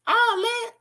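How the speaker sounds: "All that" jumped up high in a mock voice; high put-on voice. Shown as a demonstration. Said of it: "repeated in contrasting voice".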